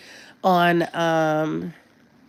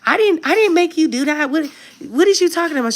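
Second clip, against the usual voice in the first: mom's defensive denial; 10 semitones higher than usual. Retold or imitated. Daughter's TikTok, mom denying waking kids, indignant high voice. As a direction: deny it all in a shrill-free high mom voice. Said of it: "the mom was like".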